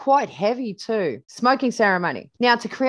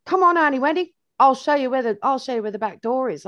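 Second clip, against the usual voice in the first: put-on eager child; 5 semitones higher, 3 dB louder than usual. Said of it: "he's going".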